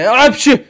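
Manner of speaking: high, loud sneeze. Acted. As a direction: burst out in a squeaky sneeze. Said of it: "hepsi hapşırıyor". (hapşırma)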